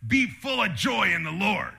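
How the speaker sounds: barked drill sergeant command. Retold or imitated. Preacher to congregation, like a drill sergeant giving orders. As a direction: bark it like a drill sergeant at a recruit. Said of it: "like a drill sergeant telling you".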